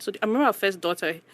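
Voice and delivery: ordinary talk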